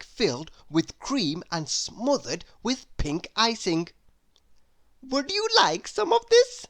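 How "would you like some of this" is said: high, bright-voiced offer. A narrator's reading. Little Miss Greedy offering her cake, high and eager. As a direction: squeak it out as a keen little character. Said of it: "asked Little Miss Greedy".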